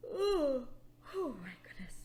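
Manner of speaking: whispered through a pretend yawn; mock yawn. Teacher showing how yawns spread: whispered mock yawn. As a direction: let the yawn swallow the words.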